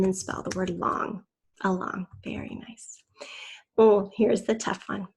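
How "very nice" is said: soft whispered "very nice"; hushed, gentle approval; whispered praise. Real, not acted. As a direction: praise them under your breath. Teacher praising a student's spelling.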